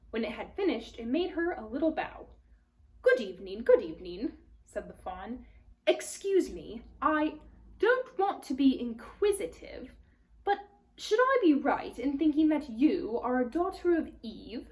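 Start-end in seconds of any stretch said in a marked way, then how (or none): 3.0-4.3 a fussy, polite faun greeting; lightly voiced faun, eager. Faun voice in read-aloud. 5.8-14.7 Mr Tumnus voiced a little higher and louder; a fussy, polite faun greeting; lightly voiced faun, eager. Faun voice in read-aloud.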